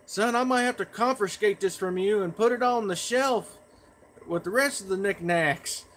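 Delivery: imitating Mom's loud, high holler. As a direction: call it through the house like a mother. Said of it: "I hear her holler saying".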